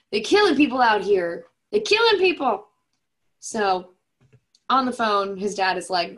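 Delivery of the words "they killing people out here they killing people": loud, high cry of alarm, building; hammed-up panic, climbing higher on the repeat; mock panic. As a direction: yell it out in comic alarm. Alarmed hero on phone, acted.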